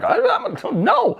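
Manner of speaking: high flustered stammering refusal; reluctant Carl. Retold or imitated. Carl refusing to join Van Helsing, a high flustered stammer. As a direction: squeak out the no. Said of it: "Carl's like".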